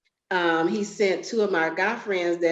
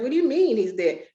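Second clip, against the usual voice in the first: stunned disbelief; 5 semitones higher than usual. Retold or imitated. Told her brother is dead.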